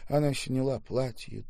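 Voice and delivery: ordinary delivery, nothing marked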